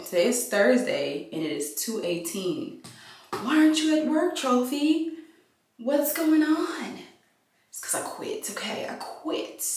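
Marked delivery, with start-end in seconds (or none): 3.4-7.0 a high nosy voice asking why she's not at work; pitched-up nosy asker; high-pitched prying voice; high questioning voice. Acted. Skit repeated. 7.7-9.6 hushed, sheepish admission; breathy secret whisper; whispered confession. Acted. Skit repeated.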